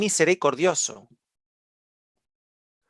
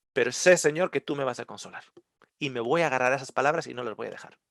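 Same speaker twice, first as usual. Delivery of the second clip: hushed, much softer trust; quiet prayer; 4 semitones lower, 7 dB quieter, faster than usual. Acted. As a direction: murmur it as a private prayer. (oración en voz baja al Señor)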